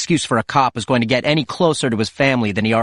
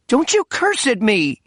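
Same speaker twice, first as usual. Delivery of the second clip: put-on much higher, sharp older woman scolding; indignant old mother snapping back; 13 semitones higher than usual. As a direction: put her grown son in his place. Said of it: "his mother said".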